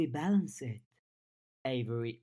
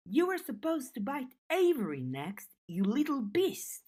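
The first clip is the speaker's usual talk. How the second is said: much higher, indignant yelp; pained protest; 10 semitones higher than usual. Acted. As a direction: squeal it out while being bitten. Bitten by Snowdrop, protests.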